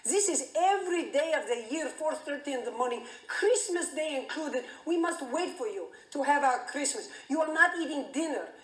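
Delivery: put-on accented, exasperated dad scolding; a worked-up father ranting at his fanatical daughter; agitated Greek father. Father to twelve-year-old daughter in the den, agitated accented rant. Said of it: "now he's agitated".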